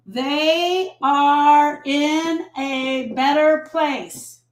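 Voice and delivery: very slow, every word stretched out; drawn-out platitude. Acted. Coach voicing what people tell grievers, drawn-out platitude. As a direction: recite the platitude with long, held words. Demonstrates the stock sentence grievers say.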